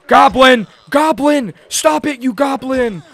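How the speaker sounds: high, loud frantic yelling; exasperated shout. Real, not acted. Player fighting off a goblin, exasperated shout. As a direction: yell at it to back off.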